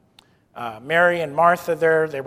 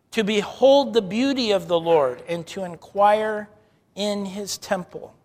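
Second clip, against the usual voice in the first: fervent scripture reading; 6 dB louder than usual. Acted. Reads psalm with fervour.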